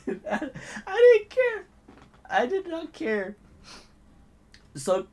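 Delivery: high, drawn-out words through laughter; laughing while talking. Real, not acted. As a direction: wheeze it out slowly, cracking up. Laughs recalling his defiance.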